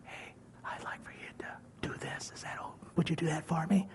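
soft unvoiced whisper; God whispering a request. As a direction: gently ask in a hush. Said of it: "But if God says".

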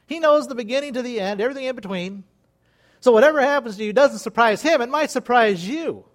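usual speaking voice

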